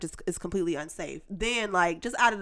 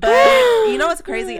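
higher, louder, building up the story; excited; 12 semitones higher, 10 dB louder than usual. Real, not acted. Friend hyping her own story to the others, loud and high. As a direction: tease the juicy part loudly.

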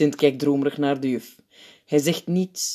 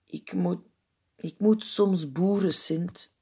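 small, halting, soft voice; start, break off, then admit it slowly; timid schoolboy owning up; hesitant confession; 3 semitones higher, 5 dB quieter, slower than usual. A narrator's reading. Nervous boy confesses to Sint.

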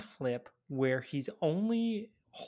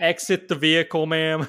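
loud stern cop command; 2 semitones higher, 9 dB louder than usual. Pretend. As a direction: order them out like a traffic cop. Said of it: "sounds like cop talk to me".

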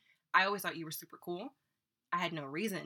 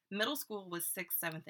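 ordinary talk

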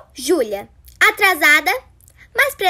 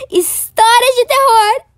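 dramatic; 7 semitones higher, 5 dB louder than usual. Acted. Dramatic announcement.

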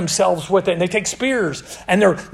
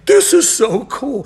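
higher, drawn-out delight; kid's awe; 5 semitones higher, slower than usual. Retold or imitated. Eleven-year-old hearing Elijah's challenge in Sunday school, awed delight. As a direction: imitate a kid slowly savoring something awesome. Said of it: "I'm like".

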